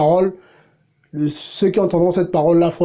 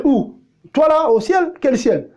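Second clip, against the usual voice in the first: incredulous retort; 6 semitones higher, slower than usual. Acted. Preacher retorts in disbelief.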